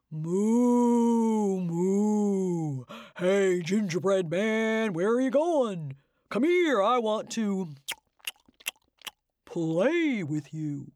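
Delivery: slow, lowing cow voice. Acted. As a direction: moo and coax him slowly.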